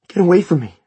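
high, loud panicked cry; voice breaking. A narrator's reading. Terrified mother warding off her son, voice breaking. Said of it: "her voice broke".

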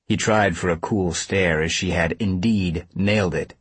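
usual speaking voice

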